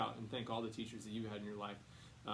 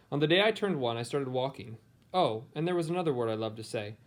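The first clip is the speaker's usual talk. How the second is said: clear, louder storybook reading voice; reading to children; 10 dB louder than usual. A narrator's reading. Teacher reading a picture book to her students, storybook voice. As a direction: read it aloud to a class of kids.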